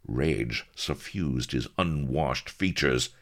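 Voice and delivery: plain reading voice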